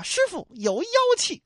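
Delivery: high, piping comic warning; alarmed disciple impression. Disciple warns master of demon air, high comic cry. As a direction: squeal it out like a cartoon disciple.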